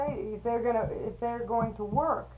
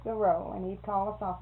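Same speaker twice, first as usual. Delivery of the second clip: normal talking voice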